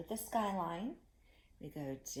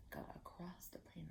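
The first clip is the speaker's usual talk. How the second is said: whisper; 16 dB quieter than usual. Shown as a demonstration. Teacher whispering the echo for the kids.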